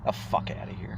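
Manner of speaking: whispered dismissive scoff. Real, not acted. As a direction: breathe it out in disgust. Scoffing end of mock quote.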